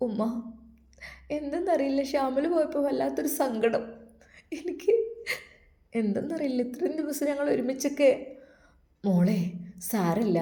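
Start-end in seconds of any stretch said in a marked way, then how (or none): 0.1-8.1 higher, softer voice, close to tears; a daughter sobbing out her sorrow to her mother; tearful girl. Acted. Daughter telling mother, in a higher tearful voice. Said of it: "bushy വിതുമ്പിക്കരഞ്ഞു".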